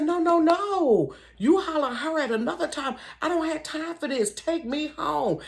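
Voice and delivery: begging him to take her home; retold protest. To the driver, a high pleading demand to go home. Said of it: "I said".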